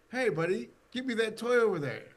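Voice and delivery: high, lightly playful character voice; lightly acted improv voice; brother in a sketch. Improv scene, brother talks to brother.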